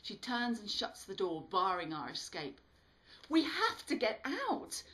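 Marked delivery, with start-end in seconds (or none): 3.3-4.8 much higher, louder urgent cry; desperate shout. A narrator's reading. Bobby shouting at Lily to let them escape. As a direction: plead it in panic.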